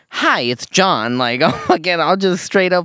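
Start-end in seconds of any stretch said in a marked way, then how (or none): 0.1-1.2 drawn-out, put-on phone greeting; slow, personable work-call greeting. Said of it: "you put on an accent".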